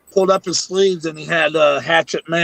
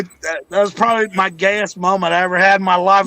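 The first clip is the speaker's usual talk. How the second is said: ordinary delivery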